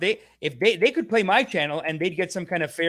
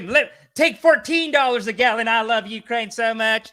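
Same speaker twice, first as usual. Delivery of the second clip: higher, smug mock gushing; hammed-up virtuous host, slowing at the end; mocking imitation; 6 semitones higher, 5 dB louder than usual. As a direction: gush like a preachy talk-show host. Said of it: "He's like".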